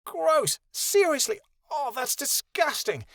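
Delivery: very high, exclaimed disgust; high-pitched revolted outburst. A narrator's reading. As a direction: recoil loudly from a foul smell. Kia hit by the stench.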